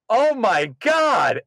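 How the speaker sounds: loud, high, very drawn-out cry; imitating an overwhelmed fan screaming; starstruck outburst. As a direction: wail it slowly in awe. Aunt's starstruck outburst, acted.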